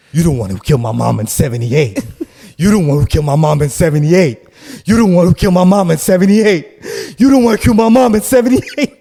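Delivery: from a flat accusation up to a high yell; building accusing yell. Retold or imitated. An unhinged man yelling at the visitor, building to a scream. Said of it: "I wanna stay in character".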